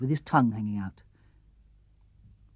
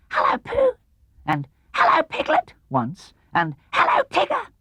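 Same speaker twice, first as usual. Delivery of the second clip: put-on very high squeaky baby voice; tiny Roo. Little Roo greeting his friends, very high squeak. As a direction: squeak each hello like a small child. Roo's voice.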